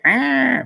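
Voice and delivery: a sharp, high mock hiss of an angry snake; quick high mock snake hiss; imitated snake hiss. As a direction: imitate the snake's startled greeting, hammed up. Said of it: "That was, that was me improvising".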